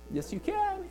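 very high, squeaky relieved yes; eager acceptance. Acted. Goat owner accepts rancher's offer, high eager relief. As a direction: jump at the offer.

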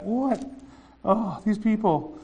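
high, slow "What? Oh, these people"; a weary ruler shaking his head; exasperated surprise. Acted. God exasperated in heaven.

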